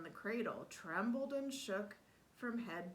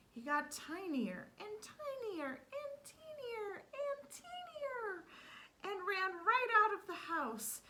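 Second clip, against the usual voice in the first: higher and higher as he shrinks, slowing; shrinking voice; 12 semitones higher than usual. A narrator's reading. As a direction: squeeze the voice up smaller and smaller.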